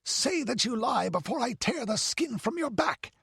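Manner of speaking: high, frantic raging shout; panting fury. A narrator's reading. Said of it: "he panted".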